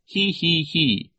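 high hee-hee-hee giggle; silly, put-on villainous titter; mock titter. A narrator's reading. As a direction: snicker high in three separate syllables. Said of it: "Nero said".

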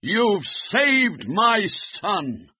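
high, slow grateful call; shout. Acted. Relieved father to Floppy, shouting. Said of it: "shouted the man".

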